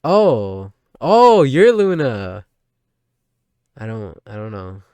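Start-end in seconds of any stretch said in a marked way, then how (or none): 0.1-2.5 very loud, high, drawn-out oh; loud shocked realization. Real, not acted. Streamer seeing the strange screen, stunned. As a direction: gasp it out in disbelief.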